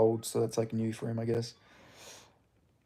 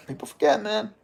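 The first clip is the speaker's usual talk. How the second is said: mock podcast refrain; 8 semitones higher than usual. Streamer mocks a podcast refrain, voice pitched high. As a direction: tease the line like a podcast host.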